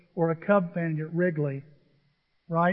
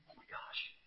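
hushed, voiceless gasp of awe; awed whisper; 24 dB quieter than usual. Retold or imitated. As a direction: breathe it out in wonder. Awed reaction on seeing stadium.

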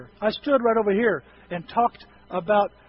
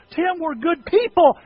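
upset wife; 7 semitones higher, 4 dB louder than usual. Retold or imitated. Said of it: "My wife calls me on the phone and says".

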